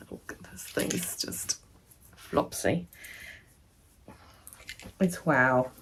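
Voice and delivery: hushed, breathless whisper of wonder; awed whisper. Real, not acted. Vlogger unwrapping a friend's fabric gift, whispering in awe. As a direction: whisper it like you can't believe it.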